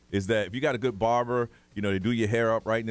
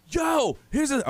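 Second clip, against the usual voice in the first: put-on excited kid; 13 semitones higher, 3 dB louder, much slower than usual. Dad voicing his excited kid.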